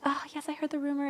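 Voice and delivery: light Irish lilt, lifted high and soft; a coy Irish actress brushing off a rumor; Irish accent. Retold or imitated. Podcaster imitating an actress's Irish accent on the red carpet. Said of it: "in her little Irish accent".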